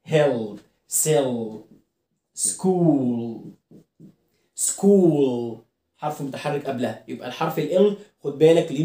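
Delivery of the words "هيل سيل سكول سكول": هيل، سيل، سكول each ending in a heavy held l; low, slow word list, thick final l on سكول; dark L. Shown as a demonstration. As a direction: draw out the "ull" ending of سكول. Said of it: "لا، هارد".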